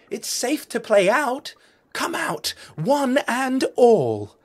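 high, loud call across the reef. A narrator's reading. Crabs calling the reef families out to play, loud joyful call. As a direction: shout the all-clear out to everyone. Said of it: "the crabs sent out the call".

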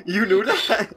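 high, slow laughing surprise; laughing disbelief. Real, not acted. Friend reveals secret knowledge.

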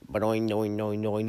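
loud ba-doing boing noises; mock head buzz sound. Describing being baked, imitating the sound in his head. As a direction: mimic the ringing in a baked head. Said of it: "and be like".

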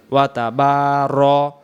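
ওয়া তাবার ending short on র; slow clipped তাবার; correct short ra. Shown as a demonstration. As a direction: say তাবার without a long ra. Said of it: "রা হবে না".

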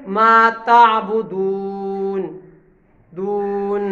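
slow, deep ताबुदून ending in n; stop on final n. Shown as a demonstration. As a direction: drop the final vowel of ताबुदून. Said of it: "जज़म जब होता है तो कैसे पढ़ेंगे?".

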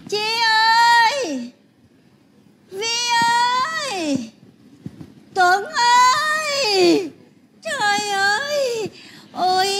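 slow drawn-out wailing cries of dismay; wailing lament. Acted. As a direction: moan over the ruined house like a mourner. Grandma wailing at messy house.

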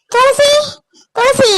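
high, urgent call of a name; anxious calling. Acted. Relative arriving at hospital calling Thulasi, anxious. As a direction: call out in worry.

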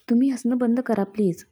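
ordinary delivery

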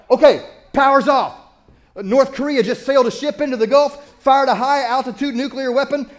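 a hammed-up prepper dad rallying the kids; frantic doomsday dad. Dad to his kids when the power cuts, frantic mock alarm. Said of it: "I'm like".

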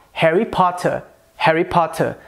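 "Harry Potter" with crisp T and silent final R, British; British accent. Shown as a demonstration. Teacher comparing three accents, British accent. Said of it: "No inglês britânico soa".

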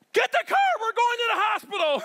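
high panicked shout. Retold or imitated. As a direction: yell in sudden panic. Panicked father after toddler's fall.

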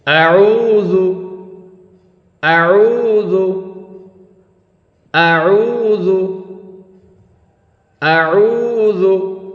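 slow drawn-out recited আউযু, teacher modelling; Quran recitation model. Shown as a demonstration. Teacher models আউযু slowly for class to echo, drawn-out recitation. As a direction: chant আউযু slowly with long vowels for students to repeat. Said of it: "আমার সঙ্গে একবার পড়েন".

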